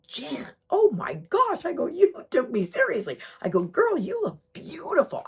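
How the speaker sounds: high, loud, drawn-out gushing; gushing amazement. Retold or imitated. Greeting dressed-up Jan at the party, gushing amazement. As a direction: exclaim over her look, stretching the words.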